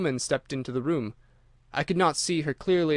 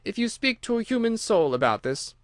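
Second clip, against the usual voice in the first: sly threat; 8 semitones higher than usual. Acted. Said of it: "tapping me lightly on the chest and giggling".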